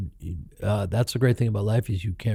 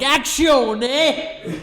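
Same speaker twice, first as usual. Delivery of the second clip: much higher, hammed-up fake Italian accent; much slower than usual. Director calling action, mock Italian accent. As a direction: call action like a cartoon Italian chef. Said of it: "doing this fake Italian accent".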